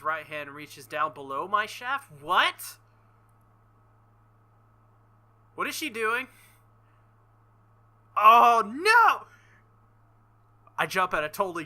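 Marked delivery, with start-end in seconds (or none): 2.2-9.3 startled. Acted. Reading shocked character lines aloud.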